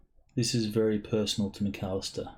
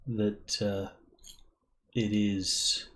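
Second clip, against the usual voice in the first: ordinary delivery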